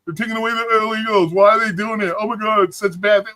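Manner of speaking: hammed-up distress, pitched high; mock outcry. Streamer mimicking people who switched their complaint, mock outcry. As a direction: imitate people wailing in protest. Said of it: "then they're like".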